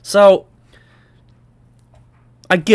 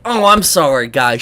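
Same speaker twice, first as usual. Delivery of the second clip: ordinary delivery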